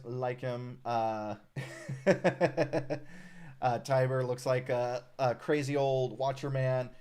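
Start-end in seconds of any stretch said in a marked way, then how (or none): 1.4-3.6 real amused laugh. Real, not acted. Streamer reacts to his new mutant, amused laugh. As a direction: run of quick chuckles.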